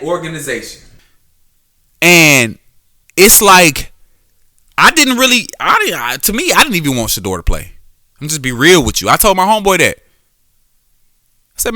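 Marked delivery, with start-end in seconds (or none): none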